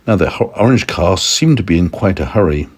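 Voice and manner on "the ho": usual speaking voice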